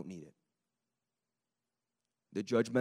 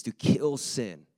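kill sin drawn out slowly; slow, weighty to kill sin; slow emphasis; 6 dB louder, much slower than usual. Real, not acted. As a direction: land to kill sin word by word. Preacher hammering the point.

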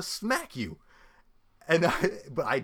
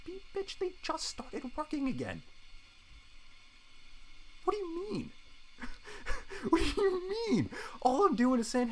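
high, disbelieving, breaking into a laugh; incredulous outrage; 13 semitones higher, 4 dB quieter than usual. Retold or imitated. Retelling being barred from goodbyes, high incredulous laughter. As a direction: squeak out the disbelief.

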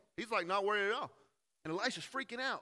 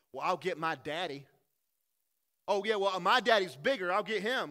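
put-on taunting playground kid; 4 semitones higher, 10 dB louder than usual. Kids quarrel on playground.

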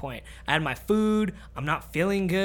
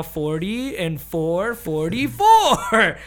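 very slow, drawn out, last word louder; slow stoned math; 5 dB louder, much slower than usual. Retold or imitated. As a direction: count it out like your brain is mush. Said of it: "the easiest math I could do".

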